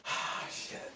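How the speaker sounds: soft whispered ah shit; whispered dismay. Retold or imitated. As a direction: mutter it, knowing you're busted. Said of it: "All just like".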